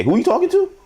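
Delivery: high, loud "Who you talking to?"; street comeback. Pretend. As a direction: snap it back high and loud. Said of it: "you say".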